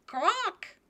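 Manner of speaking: high, grating duck quack. Acted. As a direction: squawk it like a startled drake. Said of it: "why is my dog voice so loud and grating?".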